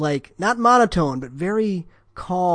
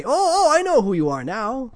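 lightly voiced customer recognising him, higher; a hint of delighted surprise, higher; recognition; 7 semitones higher, 3 dB louder than usual. Customer suddenly placing the phone voice, a touch higher.

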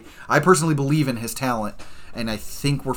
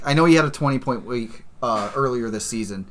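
ordinary talk

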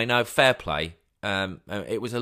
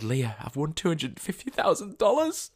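excited telling; 8 semitones higher than usual. Retold or imitated. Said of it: "so excited".

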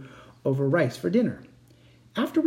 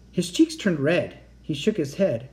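ordinary delivery

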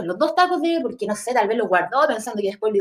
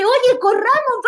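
much higher and louder alarmed call; urgent shout; 5 dB louder, much slower than usual. Retold or imitated. Daughter yelling to her mother as police charge, a high alarmed shout. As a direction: yell at her to run now.

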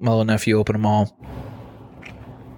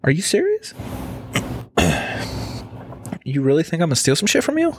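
high, quick incredulous retort; mock disbelief; 6 semitones higher, faster than usual. Telling the checkout lady off, high incredulous mock outrage. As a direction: act offended at being suspected.

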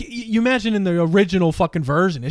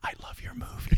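whisper; 4 semitones higher, 11 dB quieter than usual. Retold or imitated. Second kid's retold line.

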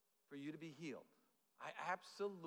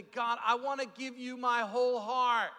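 loud, higher, drawn-out declaration to God; fervent worship cry; 5 semitones higher, 12 dB louder than usual. Retold or imitated. Worshipper offering a whole heart to God, fervent cry. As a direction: proclaim it slowly with swelling fervour.

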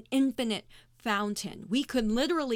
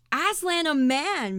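slow, stern retort, louder and higher; 6 semitones higher, 6 dB louder, slower than usual. A narrator's reading. Mister Beaver sternly corrects the children, slow and stern. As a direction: bristle at the question, slowly. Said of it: "Mister Beaver said sternly".

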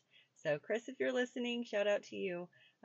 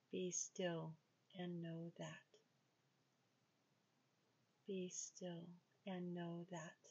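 slow, soft meditation voice, drifting into whispers; guided meditation; 6 dB quieter, much slower than usual. Acted. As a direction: guide them into stillness with long pauses. Leads a walking meditation.

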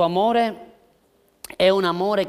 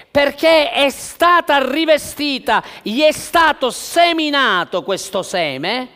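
loud, high, fervent preaching; preaching at full force; 6 semitones higher, 8 dB louder than usual. Acted. Pastor exhorting the church on love, preaching at full force. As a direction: drive it home to the congregation.

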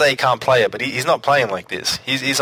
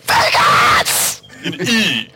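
mock shout; 7 semitones higher, 4 dB louder, much slower than usual. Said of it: "Screaming out".

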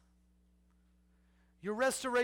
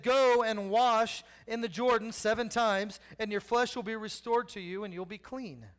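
scripture reading; 2 semitones higher, 6 dB louder than usual. Acted. Scripture read aloud.

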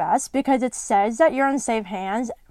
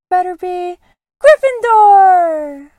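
big drawn-out Gryffindor, louder and higher; hat's announcement; 8 semitones higher, 10 dB louder, slower than usual. A narrator's reading. Sorting Hat announcing Harry's house to the hall, drawn out loud. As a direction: proclaim it to the whole hall.

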